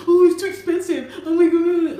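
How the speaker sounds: high, loud whiny mimic of the complaining manager; mocking complaint. Imitating the stingy manager griping about the hotel cost, whiny and high. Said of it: "He was always complaining".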